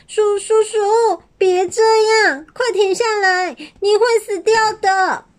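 high tearful begging; crying plea. A narrator's reading. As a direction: sob out the plea.

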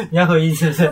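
teasing question through real laughter; laughing tease. Real, not acted. Host teasing co-host, laughing as she asks. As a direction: laugh while poking fun at her.

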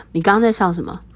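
usual speaking voice

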